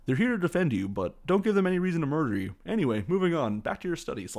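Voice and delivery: high, loud, incredulous parody; mock breezy announcement. Headmaster casually warning kids about murder, loud high parody. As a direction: rattle off danger as if routine. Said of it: "you can't just be like".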